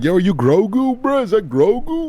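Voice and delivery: very high squealed call; mock heckler. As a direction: imitate a passer-by hooting in falsetto. Acting out people hailing him as Grogu.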